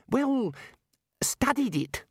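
high, reedy protest; earnest young wizard. Acted. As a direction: Ponder objects to the Arch-Chancellor, voice up high. Ponder voiced high.

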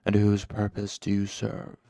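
cool, controlled interrogation; cool dark master. Acted. Haliax interrogates his suffering servant, coolly commanding. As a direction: press the question without hurry. Voices Haliax questioning Cinder.

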